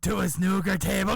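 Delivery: loud, punched punchline with rising end; scandalised reveal. Retold or imitated. Man on a bus reveals the awful detail, loud scandalised reveal. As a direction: drop the shocking detail like a bombshell. Gossiper repeats the shocking reveal.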